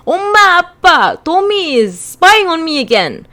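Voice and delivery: high, loud tattletale yell; shouting. Acted. Girl telling on her brother to Omma and Appa, shouting. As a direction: shout it down the hall to your parents. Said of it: "shout into the hall".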